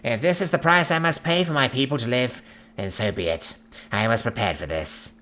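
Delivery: resigned alien ruler voice; an alien accepting his fate. A narrator's reading. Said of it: "Generic alien voice".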